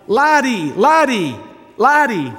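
high, loud calling for the dog, each name falling; imitated yell. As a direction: call a dog home from far off, acted out. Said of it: "And so they yelled".

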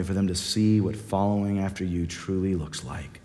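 closing prayer. Acted. Closing prayer over the congregation.

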